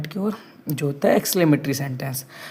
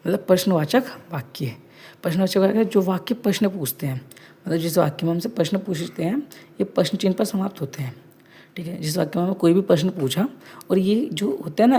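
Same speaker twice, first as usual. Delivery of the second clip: ordinary delivery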